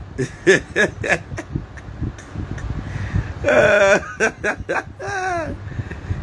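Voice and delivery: long high wheezing laugh; real laugh. Real, not acted. Vlogger laughing at his own party story, long high laugh. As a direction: crack up and keep laughing.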